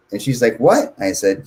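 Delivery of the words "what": high, louder startled question; imitated surprise. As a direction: play the receptionist caught off guard. Receptionist's startled reaction, retold.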